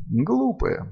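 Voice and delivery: high, softened gentle scolding; mild, weary reproach; sighed reproach. A narrator's reading. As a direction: sigh it at a foolish servant. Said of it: "вздохнул Хедин".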